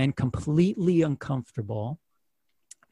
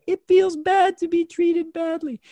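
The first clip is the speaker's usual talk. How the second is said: put-on very high, slow small-child voice; childlike simple line, pitched way up; little child; 14 semitones higher, 3 dB louder than usual. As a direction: say it like a rehearsed little boy. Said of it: "I would say".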